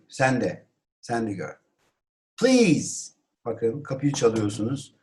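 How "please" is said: high-pitched call; exclamation. Shown as a demonstration. Teacher models knocking on a door, exclaimed. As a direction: cry out at the door. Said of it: "Bunu haykırarak söylüyorsunuz".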